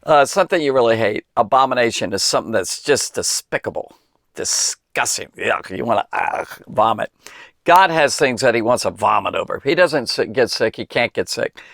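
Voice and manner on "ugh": mock gag. Miming disgust.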